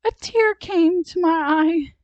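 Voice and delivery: put-on high, loud childlike explanation; polite little girl. A narrator's reading. Sally explains her sob.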